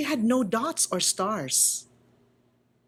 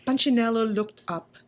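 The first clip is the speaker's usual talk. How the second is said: ordinary delivery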